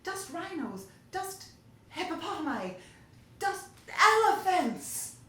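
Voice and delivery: high, loud gleeful building list; furious glee. A narrator's reading. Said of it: "Bea joined in with furious glee".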